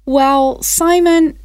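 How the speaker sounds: ordinary talk, nothing marked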